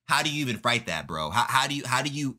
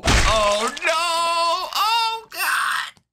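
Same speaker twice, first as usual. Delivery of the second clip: much higher shrieks, building to a scream; anguished screams; 5 dB louder, much slower than usual. Acted. Kid screaming while being pummelled, anguished. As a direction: scream in pain and terror.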